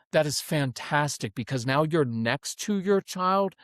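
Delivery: ordinary talk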